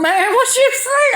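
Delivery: high, loud, offended outburst; indignant shout. Retold or imitated. Retelling how he shouted at the phone store clerk, indignant. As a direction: shoot back like he's been insulted.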